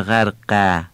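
"غرقه" as light "ghærqææ"; thin final alef. Shown as a demonstration. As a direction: say غرقه with fronted "æ" after q. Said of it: "نمیگیم". («غرقه» با الف نازک)

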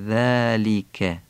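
ذالک said slowly with an interdental ذ; Arabic ذ. Shown as a demonstration. Teacher models ذالک, very slow. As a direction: say ذالک with the tongue between the teeth. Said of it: "و کسره و کاف ذالک".

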